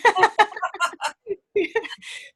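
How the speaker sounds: a long laugh that starts high and falls; high burst of laughter. Real, not acted. Laugh after line.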